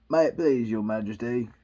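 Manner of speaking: low, humble servant's voice. Acted. Two kneeling to the Queen, very humble. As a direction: drop low and defer. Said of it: "in a very humble tone".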